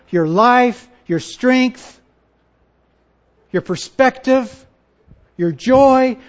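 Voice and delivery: high, loud, forceful preacher; impassioned preaching. Real, not acted. Preacher challenging followers of Jesus, impassioned and loud. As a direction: drive each phrase home to the room.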